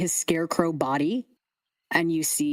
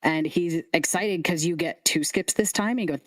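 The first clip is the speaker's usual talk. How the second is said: normal talking voice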